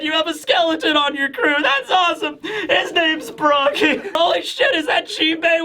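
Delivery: a tearful reunion, high and breaking into laughter; reunion voices. Acted. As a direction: play both friends crying and laughing at once. Acted tearful reunion dialogue.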